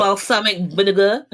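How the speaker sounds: put-on thick New York accent on the vinegar. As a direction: say it like a New Yorker. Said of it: "also do really good New York accents".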